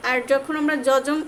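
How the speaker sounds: normal talking voice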